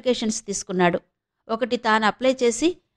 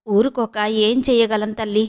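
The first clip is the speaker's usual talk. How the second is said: plain reading voice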